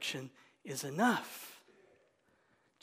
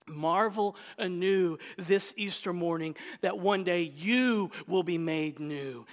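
normal talking voice

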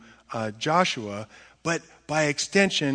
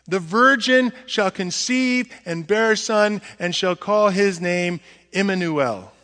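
higher, louder prophet proclaiming; prophet Isaiah; 5 semitones higher, 7 dB louder than usual. Acted. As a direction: announce the sign to the king. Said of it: "And he says".

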